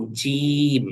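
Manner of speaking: জিম with a pressed, weighted j; Arabic jeem. Shown as a demonstration. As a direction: lean into the j of জিম. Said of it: "একটু ভর দিয়ে উচ্চারণ করতে হবে". (ভর দিয়ে জিম)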